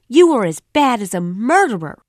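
loud, high, hitting "you" hard; furious shout. A narrator's reading. Girl accusing the boy of being a murderer, shouting. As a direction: fling the accusation at him at full voice. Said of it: "I shouted at him".

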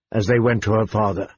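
ordinary delivery

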